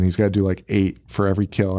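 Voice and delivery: ordinary talk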